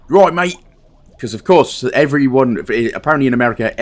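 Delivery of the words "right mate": mock British bobby accent; chirpy cockney cop impression; hammed-up London copper. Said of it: "That's what British police officers say".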